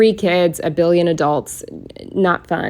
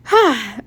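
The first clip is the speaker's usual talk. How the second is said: big audible sigh, pitched up; heavy sigh; 6 semitones higher, 4 dB louder than usual. Real, not acted. Vlogger done with a long weekly recap, sighing. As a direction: let out a loud breath at finishing.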